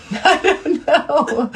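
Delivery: slow, laughing through the words. Real, not acted. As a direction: giggle while answering. Distracted, laughing at interruption.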